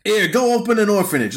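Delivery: high-pitched put-on mobster voice; generous mobster act. Joker as Capone handing out cash, mock generous. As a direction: toss it off as a big-spending gangster.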